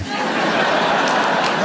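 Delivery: hearty laugh; loud laugh. Real, not acted. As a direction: burst of laughter. Big laugh at own joke.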